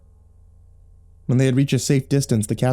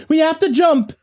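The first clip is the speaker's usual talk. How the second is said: put-on yelling girl; 14 semitones higher than usual. A narrator's reading. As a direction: yell it over the chaos. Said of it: "the girl yelled".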